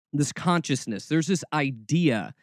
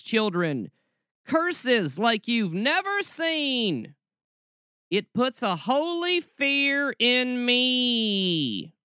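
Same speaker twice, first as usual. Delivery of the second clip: Southern preacher parody climbing high and drawn out; hammed-up Southern doom-preaching, rising in pitch; mocking Southern accent; 5 semitones higher, slower than usual. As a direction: build a mock Southern curse to a long, high wail. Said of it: "my Southern roots come out".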